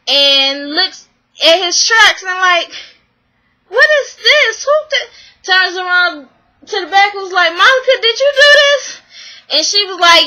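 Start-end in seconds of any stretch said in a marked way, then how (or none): 3.7-7.5 high, mock-outraged questioning; indignant disbelief. Outraged discovery acted in retelling. 7.5-9.0 high-pitched mock accusation; shocked accusation. Retold accusation after shocked discovery.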